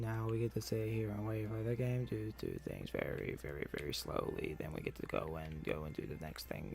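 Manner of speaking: low, quiet, unenthused mutter; deadpan grumbling. Real, not acted. Player stuck waiting on the game, deadpan grumbling. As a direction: grumble softly with a joyless hooray.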